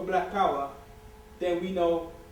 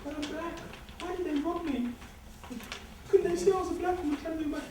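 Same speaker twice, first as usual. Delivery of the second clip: mock indignant woman, higher and fast; hammed-up outraged victim; robbed woman; 7 semitones higher, 4 dB quieter than usual. Robbed woman complaining about the thieves, mock high and fast.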